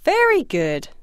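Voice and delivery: normal talking voice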